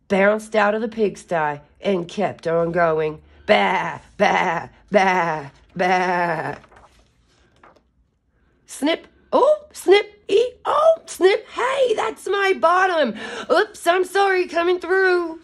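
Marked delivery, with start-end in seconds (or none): 3.5-6.5 loud bleating baa; sheep bleats. Acted. Barnyard sheep bleating at the chase, loud baa. 8.7-15.4 very high, loud squeak of a small critter; squeaky voice. Acted. Egg squeezes past a barnyard animal, squeaky high voice.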